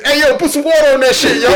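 high, loud complaining holler; inmate calling out. Acted. Inmate yelling at cellmate over the toilet, calling out.

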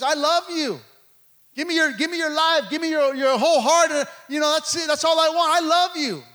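high, loud, fervent appeal; impassioned pulpit declaration; full-force preaching. Acted. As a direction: voice God's plea with urgency. Preaches as God speaking.

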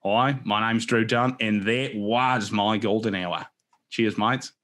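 slow, drawn-out Aussie intro; Australian accent. Pretend. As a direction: play it like a laid-back Australian host. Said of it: "maybe we can take it down under".

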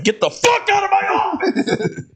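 very high, yelling half-joke; pretend shout. Pretending to throw the coworker out, yelling. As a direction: scream it in mock fury. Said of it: "I'm like".

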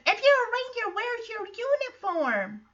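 put-on high-pitched child's voice; imitated questioning kid. Teddy challenging the ranger about his uniform, imitated kid.

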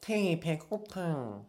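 put-on slow, cute baby talk. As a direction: stretch it out like a whiny toddler. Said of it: "In my baby voice?".

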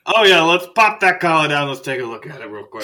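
loud, high start that eases off; hearty doctor. Acted. Doctor telling embarrassed patient not to worry, hearty. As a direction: wave it away breezily.

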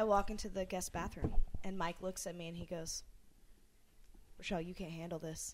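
low, moody noir narration; hardboiled detective voiceover. Pretend. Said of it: "film noir voice that you're narrating".

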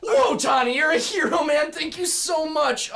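high loud cheering; excited cheer. Acted. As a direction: cheer the hero on. Cheering Donnie as hero.

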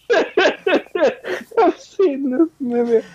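slow, high words broken by laughter; can barely get it out for laughing; laughing through words. Real, not acted. Host recalling the terrible movie, laughing slowly. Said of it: "burst into laughter just by the name of it".

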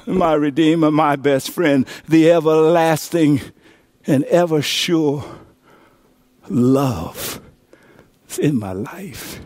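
hushed devotion; tender. Real, not acted. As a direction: bring it down lovingly. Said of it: "softening after climax".

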